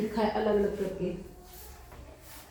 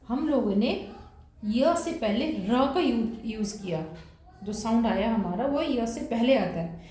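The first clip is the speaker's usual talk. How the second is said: ordinary delivery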